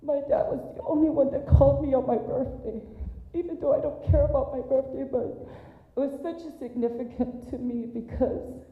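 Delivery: high, strained voice fighting back tears; real emotion cracking through the words; voice breaking. Real, not acted. Remembering dad's birthday call.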